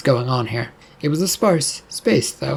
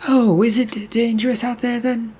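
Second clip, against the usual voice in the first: nervous; 9 semitones higher than usual. Acted. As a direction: voice climbs high, hesitant and worried. Frightened woman asks about danger.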